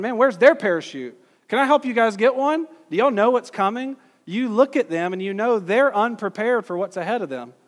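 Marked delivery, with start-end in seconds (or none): 0.0-4.0 higher and louder, urgently helpful; excited, caring passenger warning others; eager concern. Retold or imitated. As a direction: call out to them, eager to help. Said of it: "You're going".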